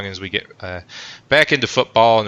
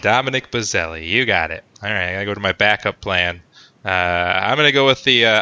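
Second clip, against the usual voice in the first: usual speaking voice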